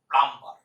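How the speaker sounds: "প্লাম্বার" said with b and r sounded, the common mistake; wrong pronunciation. Shown as a demonstration. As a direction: show the error "প্লাম্বার" with a loud falling tone. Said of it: "ভুল করে বলবেন না".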